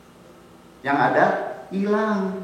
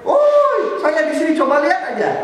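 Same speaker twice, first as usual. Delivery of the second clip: high calling shout; yelling lost in woods; 12 semitones higher, 4 dB louder than usual. Retold or imitated. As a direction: holler for help from the forest. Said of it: "pasti yang teriak-teriak". (teriak-teriak minta tolong di hutan)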